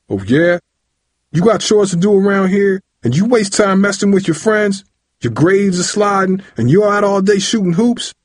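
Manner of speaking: high, indignant father's rant; angry scolding. A narrator's reading. Father berates son for wasting time, angry scolding. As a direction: tear into him over his slacking.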